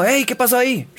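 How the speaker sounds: high, surprised "hey" to someone; calling out. Shown as a demonstration. Teacher acting a memory trick for A, high call-out. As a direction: call out as if asking what happened. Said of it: "Así como".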